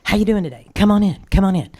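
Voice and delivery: high, eager welcome, tumbling out fast at the end; cheerful store greeting. Retold or imitated. Card shop owner welcoming a walk-in customer, high and eager. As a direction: greet a customer walking in, all enthusiasm. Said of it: "we'd say".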